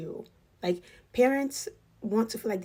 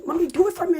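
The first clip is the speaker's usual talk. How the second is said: put-on child's whine; 10 semitones higher, 8 dB louder, faster than usual. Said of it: "he would cry".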